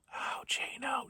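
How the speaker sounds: slow, suspicious whisper; a slow whispered how'd she know. Acted. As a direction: hiss it to yourself in paranoia. Paranoid quote, trailing into whisper.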